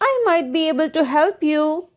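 high, smooth fox voice; sly storybook fox, silky. A narrator's reading. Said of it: "with a silky voice".

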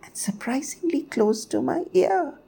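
high, hushed bird voice; tiny bird. A narrator's reading. Bird confides how near the sun was, high and hushed. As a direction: squeak it softly, almost whispering. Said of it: "said Bidhi".

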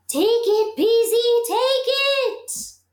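very high, loud singsong call; crackling fire voice. A narrator's reading. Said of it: "crackled the fire".

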